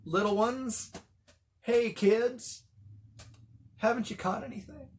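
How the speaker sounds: playful taunt from the beach; heckling greeting. Retold or imitated. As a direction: needle them like little kids. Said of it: "a heckling kind of greeting".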